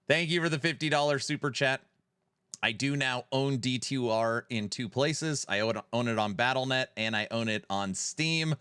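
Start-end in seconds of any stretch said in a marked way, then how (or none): none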